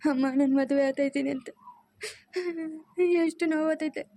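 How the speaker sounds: anguished, tearful wail; crying. Acted. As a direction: sob it out in pain, dragging the last words. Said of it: "ಅಳ್ಬೇಡಮ್ಮ".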